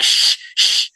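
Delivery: unvoiced mock shushing of the crowd; hushing. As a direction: hush him like a nervous onlooker. People hushing him at the gate.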